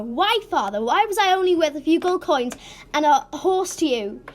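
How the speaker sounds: loud, high, wounded outburst; hurt protest. Acted. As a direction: confront him in loud, hurt disbelief. Distraught daughter confronts her father.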